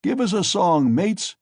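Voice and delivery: high, loud call; shouted. A narrator's reading. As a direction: shout it across the cart. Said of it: "shouted someone in the cart".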